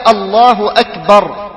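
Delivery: أكبر ending on a silent ra, akbar; الله أكبر with final ra still. Shown as a demonstration. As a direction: stop on the ra of أكبر with sukun. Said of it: "بالتسكين".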